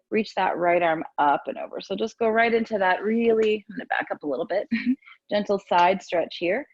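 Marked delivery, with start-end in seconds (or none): none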